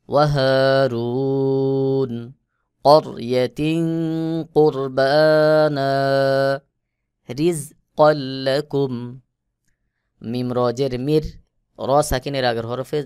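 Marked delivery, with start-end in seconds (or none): 0.1-8.9 slow, chanted, louder recitation; Quran recitation. Shown as a demonstration. Teacher recites the verse words for students, slow chant. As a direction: intone each word like tajweed.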